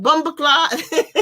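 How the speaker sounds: high, slow Jamaican curse, laughing; drawn-out mock Jamaican exclamation; Jamaican patois accent. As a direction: imitate a Jamaican accent with amusement. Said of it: "in that accent".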